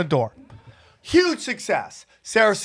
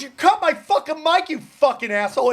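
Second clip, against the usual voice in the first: retold angry yell; 7 semitones higher than usual. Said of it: "She goes".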